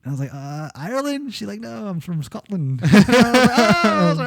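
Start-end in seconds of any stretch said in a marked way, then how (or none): 0.3-1.3 mock Scottish accent. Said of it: "she had a European accent". 1.6-2.8 lightly imitated Scottish accent, slow and soft; a hint of an old Scottish lady, slowed; retelling the flea market lady's reply in a light Scottish accent; mock Scottish accent. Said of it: "she had a European accent".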